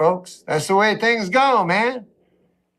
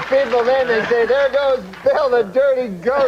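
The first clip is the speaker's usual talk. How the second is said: put-on quoting old friend; 6 semitones higher than usual. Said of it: "he says".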